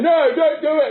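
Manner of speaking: high, urgent cry of protest; mock pleading crowd. Audience begs Punch to spare the baby, high mock plea. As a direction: plead like an alarmed audience, hammed-up.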